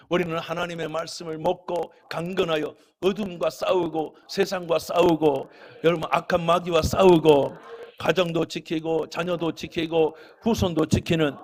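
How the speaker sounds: fiery preacher building to a blessing; preaching at full force. Acted. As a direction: thunder it from the pulpit. Preaching climax to the congregation.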